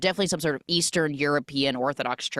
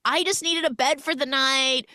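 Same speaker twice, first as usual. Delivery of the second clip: higher-pitched retold pleading; awkward excuse; 9 semitones higher than usual. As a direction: act out explaining yourself to the girlfriend. Acting caught woman explaining herself.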